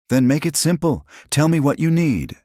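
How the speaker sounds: high, loud urgent pleading; desperate plea. A narrator's reading. Minho begs Destiny to let him help. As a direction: beg her, pitched high.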